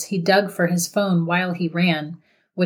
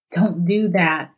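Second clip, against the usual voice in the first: begging. A narrator's reading. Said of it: "Noah begged".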